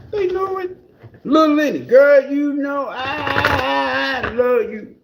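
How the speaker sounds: high, slow, wailing "I love you"; hammed-up tearful plea. Ex begging Little Lenny for forgiveness, high sobbing plea.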